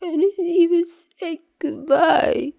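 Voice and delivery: very high, slow, crying whine; tearful kid. Acted. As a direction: wail it like a drugged kid in tears. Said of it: "He starts sniffling as big fat tears".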